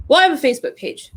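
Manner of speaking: fast, high mocking echo of "I have a Facebook page"; mocking repeat. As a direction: throw "I have a Facebook page" back at the client. Mocking the client's excuse.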